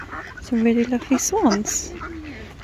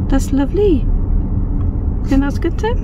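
higher, louder try at a Welsh accent; 5 semitones higher, 4 dB louder than usual. Shown as a demonstration. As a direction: put on a sing-song Welsh accent. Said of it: "Could try a Welsh accent for you".

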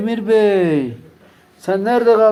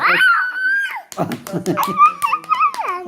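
shrieking laugh. Real, not acted. Laughing after head is shaved.

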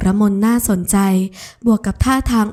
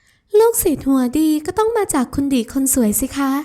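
put-on teasing sweet girl; 5 semitones higher than usual. Ramon teases Adisa for acting cute, high and playful. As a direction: tease her fondly, voice lifted.

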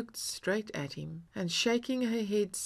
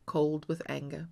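ordinary delivery

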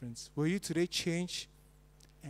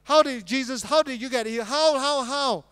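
louder, higher mock demanding "how"; Pharisees questioning; 8 semitones higher, 11 dB louder than usual. Preacher mimics Pharisees to the congregation, mock insistent questioning. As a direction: hammer the doubters' question. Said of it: "that's what the Pharisees did".

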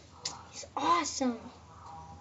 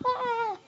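mock yell; 10 semitones higher than usual. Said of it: "He's gonna be like".

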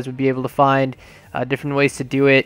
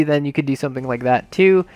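ordinary talk, nothing marked

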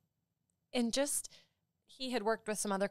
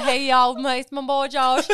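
put-on higher, loud little-kid Southern accent; 5 semitones higher, 12 dB louder than usual. Friend doing a favorite little kid on request, playful Southern accent. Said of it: "that has the Southern accent".

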